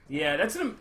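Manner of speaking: ordinary talk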